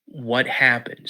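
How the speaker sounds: father calming his scared son slowly; calming dad. Retold or imitated. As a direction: settle a panicked child gently. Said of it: "calm down".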